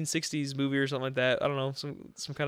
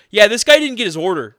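much louder, pitched up announcement; loud call-out; 6 semitones higher, 14 dB louder than usual. Retold or imitated. Worker telling the cook in front of the line, loud pointed call-out. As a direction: call it out so the whole line hears. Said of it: "I'm being an asshole about it".

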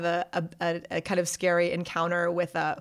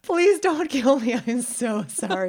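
much higher, louder, slow pleading, hammed up; mock frightened plea; 6 semitones higher, 5 dB louder, slower than usual. [name] begging the angry local: mock terrified, higher, slow. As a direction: beg for your life in a comic panic.

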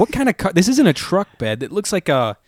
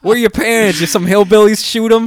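higher, louder mock Chicago voice; Chicago accent; 7 semitones higher, 6 dB louder than usual. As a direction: mimic the coyote guy, hamming it up. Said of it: "make it hilarious".

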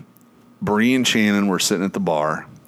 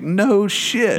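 mock amazed, pitched way up; 12 semitones higher than usual. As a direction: squeak it out in disbelief. Retold himself, amazed at coincidence.

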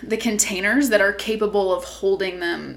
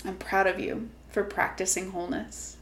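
guided meditation; 5 dB quieter than usual. Acted. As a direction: gently guide them inward.

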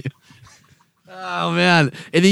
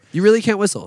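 ordinary talk

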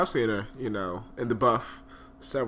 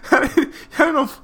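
laughing; 9 dB louder, slower than usual. Real, not acted. Laughing at grifter remark.

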